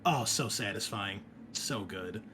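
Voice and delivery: usual speaking voice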